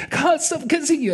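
high-pitched mock gushing dad; gushing father. Father smothers returning son with kisses.

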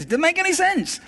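high-pitched incredulous outburst; exasperated squeak of disbelief; baffled protest. Retold or imitated. Retold inner argument, baffled.

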